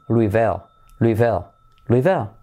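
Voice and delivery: LOUISVILLE repeated as "LOO-ee-vul"; correct English pronunciation. Shown as a demonstration. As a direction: drill LOUISVILLE without the S. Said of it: "se pronuncia".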